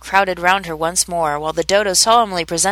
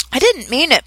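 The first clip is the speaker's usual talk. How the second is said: high-pitched plea; pleading; 7 semitones higher than usual. A narrator's reading. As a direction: plead innocence, voice raised in pitch. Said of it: "pleaded poor Alice".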